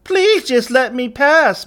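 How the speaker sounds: very high, slow mocking falsetto; mocking effeminate voice. A narrator's reading. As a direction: mimic his plea in a loud, girlish sing-song. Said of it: "mocked the leader of the gang in an effeminate voice".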